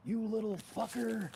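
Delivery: high, loud, drawn-out movie impression; mock anguished plea. Owner seeing his dog avoid cracks, mock anguished plea. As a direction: wail slowly at the dog, hammed up.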